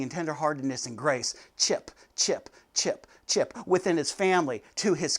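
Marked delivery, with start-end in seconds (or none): 1.6-3.5 unvoiced rhythmic whisper, tapping each chip. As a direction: whisper the strokes like a chisel. Whispered chisel strokes.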